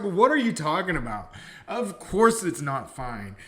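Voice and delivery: loud, high outburst of disbelief; can't believe what he just heard, loud; shocked disbelief. Real, not acted. Professor reacts to student saying it was fine, shocked loud disbelief.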